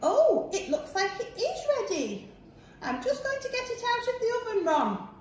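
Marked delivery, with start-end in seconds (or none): none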